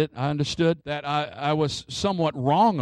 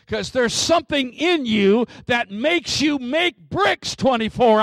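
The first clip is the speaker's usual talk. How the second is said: raised, loud declamation; full-force preaching; 9 semitones higher, 6 dB louder than usual. Real, not acted. Preacher urging the church to defy their Pharaoh, full-force proclamation. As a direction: build to a commanding shout.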